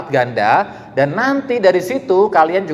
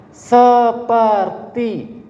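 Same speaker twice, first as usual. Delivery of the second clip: "Seperti" drawn out very slowly and high, falling; mocking, drawn-out "Seperti"; stressed seperti; 5 semitones higher, much slower than usual. Shown as a demonstration. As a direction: stretch "Seperti" long and high to doubt it. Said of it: "Kalau seperti kan berarti bukan beneran".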